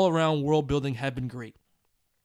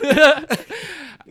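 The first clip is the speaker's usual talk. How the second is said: loud laugh; 11 semitones higher, 10 dB louder than usual. Real, not acted. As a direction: burst of high laughter. Host laughs at late email.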